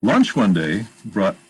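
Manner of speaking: usual speaking voice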